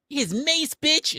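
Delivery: high-pitched mock yell; hammed-up shrill mace threat; mock cop yelling. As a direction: squeal it like an overexcited cop. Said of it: "They're just like".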